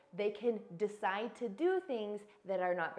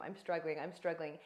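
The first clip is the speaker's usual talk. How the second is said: struggling; 4 semitones lower, 4 dB quieter than usual. Retold or imitated. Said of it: "I was texting him".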